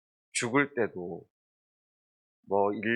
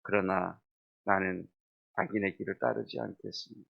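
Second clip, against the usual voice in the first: quiet confession; 6 semitones lower, 5 dB quieter than usual. Retold or imitated. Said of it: "우리가 고백해야 될 것".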